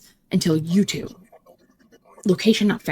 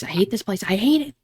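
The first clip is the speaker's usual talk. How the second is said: ordinary delivery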